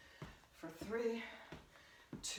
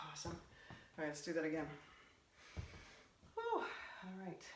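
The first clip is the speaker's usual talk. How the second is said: breathless after cardio; panting between words; out of breath; 3 semitones lower, 8 dB quieter than usual. Real, not acted. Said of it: "Okay, I'm out of breath".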